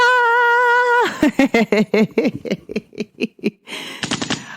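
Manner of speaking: high laugh. Real, not acted. Signing off the show.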